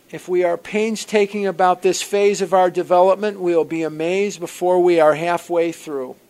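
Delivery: a touch of formal recital; reading aloud. Acted. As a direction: read the promises like a solemn text. Reads the program's promises.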